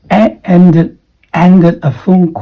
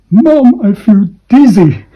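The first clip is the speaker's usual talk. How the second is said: high, loud whiny call to Mom; acted sick child; 6 semitones higher, 5 dB louder than usual. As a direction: play the queasy kid on a plane. Feeling sick on a plane.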